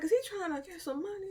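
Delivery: high, tearful whimper; a teary, high little cry; hurt inner voice. Retold or imitated. A high, teary voice doubting her ex. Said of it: "I'm like".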